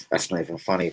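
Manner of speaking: ordinary delivery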